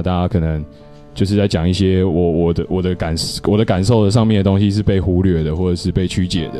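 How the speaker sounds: ordinary talk, nothing marked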